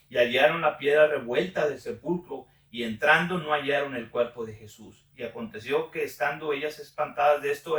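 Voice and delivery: loud, measured Bible reading voice; solemn reading aloud of scripture. Acted. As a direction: proclaim the passage to the group. Reads Gospel passage aloud.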